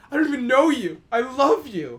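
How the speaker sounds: high, loud, overjoyed gush. Retold or imitated. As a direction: swoon at your rescuer. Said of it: "And I was like".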